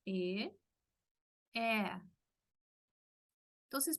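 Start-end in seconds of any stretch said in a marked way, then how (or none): 0.1-2.0 E then E, the first open É, the second closed Ê; open versus closed E. Shown as a demonstration. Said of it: "¿Vieron la diferencia?". (E abierta y E cerrada)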